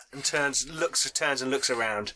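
normal talking voice

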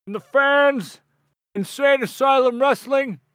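grand, high wrestler speech to the fans; wrestling promo. Acted. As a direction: hype up the crowd like a wrestler on the mic. Continues the promo.

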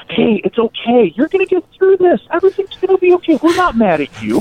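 high, fast sing-song fake comfort; mock soothing. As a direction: play the robber sweetly calming her. Robbers mock-comforting terrified girl.

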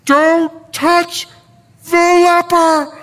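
a frantic friend begging him to stop; panicked disciple. Retold or imitated. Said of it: "and Andrew were freaking out".

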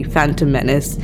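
ordinary talk, nothing marked

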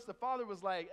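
ordinary talk, nothing marked

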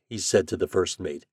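plain reading voice

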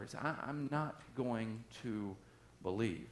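hushed doubt. Acted. Thomas voiced doubting, hushed.

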